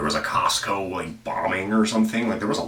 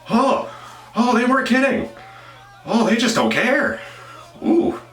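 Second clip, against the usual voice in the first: high, loud horrified exclamations; shocked outcry; 6 dB louder than usual. Real, not acted. Host reacting live to a brutal video, loud shocked cries. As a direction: yelp out in real shock.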